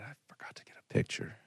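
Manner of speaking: whispered, dazed; whispering in the silent van afterwards; stunned whisper. Retold or imitated. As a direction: breathe it out in shock.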